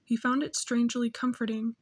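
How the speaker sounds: ordinary delivery, nothing marked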